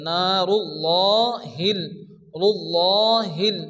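slow নারুল্লাহিল with a thick, rounded "llo"; full heavy lam. Shown as a demonstration. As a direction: make the double l of রুল্লাহিল full and long. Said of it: "লামকে পুর অর্থাৎ ল".

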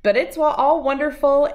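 ordinary delivery, nothing marked

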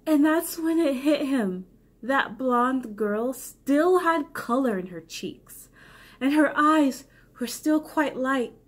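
ordinary delivery

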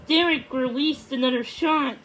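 ordinary delivery, nothing marked

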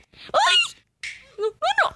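very high, loud shriek then panicked noes; terrified scream. Real, not acted. Gamer killed by another player, terrified scream. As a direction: shriek in terror.

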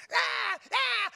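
high, loud mock baby screaming; hammed-up infant shrieks; imitated baby wail. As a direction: wail like a baby laid on a cactus. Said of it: "you sounded off like they laid you on a cactus".

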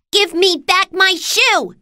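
put-on very high, loud, drawn-out girl's demand; angry shout. A narrator's reading. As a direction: shout it furiously as Dorothy. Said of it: "she shouted at the Wicked Witch".

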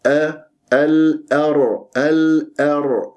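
slow الأر, thin hamza then heavy r; separated hamza. Shown as a demonstration. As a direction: keep the hamza of الأر light before the dark ر. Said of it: "حتى أبين لك أنا التخليص".

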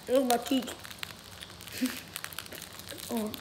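ordinary talk, nothing marked

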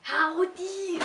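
hammed-up German-accented greeting. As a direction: bark it in a mock German accent. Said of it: "It's howdy in German".

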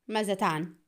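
plain reading voice

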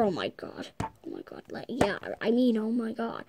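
hushed whispered oh my god; scared little whisper; whispered shock. Acted. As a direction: breathe it out in awe. Shocked in dark toy building.

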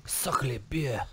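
quiet mock-French attempt; bad French accent. Shown as a demonstration. Co-host demonstrating on request, quiet bad French accent. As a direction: softly fake a French accent. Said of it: "let me hear your French accent".

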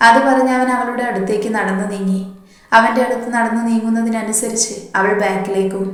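usual speaking voice

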